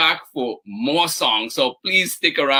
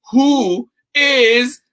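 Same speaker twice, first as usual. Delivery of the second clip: high, loud, drawn-out reveal; big cheering build-up; excited announcement; 8 semitones higher, 4 dB louder, slower than usual. Real, not acted. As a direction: stretch it out like a game-show host. Builds up to prize draw.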